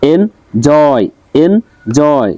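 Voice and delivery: slow এন, then জয়, in এনজয়; syllable by syllable. Shown as a demonstration. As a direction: break এনজয় into two drawn-out beats. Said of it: "এখানে দুইটা দেওয়া আছে".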